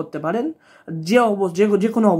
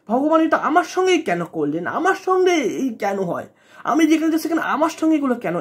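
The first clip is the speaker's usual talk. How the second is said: higher-pitched, imploring "why me" questioning; mock plaintive complaint; 4 semitones higher than usual. Voicing a troubled devotee asking God why, plaintive complaint. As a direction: act out an aggrieved plea, pitch swooping up.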